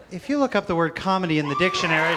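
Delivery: ordinary delivery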